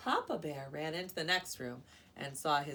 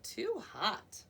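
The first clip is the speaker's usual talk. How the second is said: cry; 4 semitones higher, 5 dB louder than usual. A narrator's reading. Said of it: "she cried".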